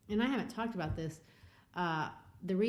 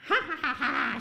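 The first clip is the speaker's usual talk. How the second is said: higher, louder girlish mocking cackle; mocking laugh; 8 semitones higher, 7 dB louder than usual. Pearl laughing at the minister, mocking laugh. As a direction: laugh right in his face. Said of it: "She laughs at him".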